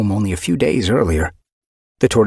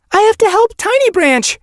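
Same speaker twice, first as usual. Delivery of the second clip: desperate shout; 7 dB louder than usual. Acted. Mothflight defying her mother.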